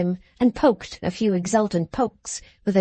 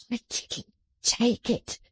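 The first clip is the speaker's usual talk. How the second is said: dismal; 2 semitones higher, 6 dB quieter, slower than usual. Acted. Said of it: "the dismal youth replied".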